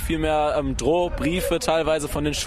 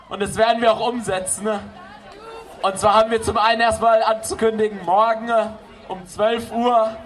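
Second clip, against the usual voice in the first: raised, projected address to a crowd; rally speech; 5 semitones higher than usual. Real, not acted. Organizer announces next strikes to the demo crowd, projected speech voice. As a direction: announce plans to the whole square.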